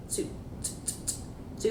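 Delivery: "Tsu" repeated as a whispered ts- burst; TS sound drill. Shown as a demonstration. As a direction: break "tsu" into sharp ts- bursts. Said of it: "get that sound correct".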